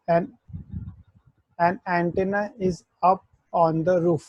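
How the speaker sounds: very slow, word by word; slow mnemonic. Shown as a demonstration. Teacher giving students a slow memory trick for the kana. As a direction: spell out the memory sentence slowly. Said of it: "याद करने का सही एक तरीका".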